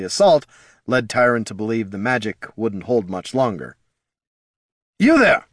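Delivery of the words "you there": loud high call across the crowd; shout. A narrator's reading. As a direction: yell to catch the soldier. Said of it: "he shouted".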